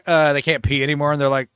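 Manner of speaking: ordinary talk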